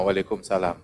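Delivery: curt mumbled greeting back; cold reply. Acted. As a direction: brush him off coldly. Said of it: "jawabannya sama".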